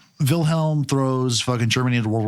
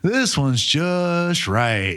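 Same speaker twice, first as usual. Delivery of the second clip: goofy storybook voice; 6 semitones higher, slower than usual. Retold or imitated. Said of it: "It's just like".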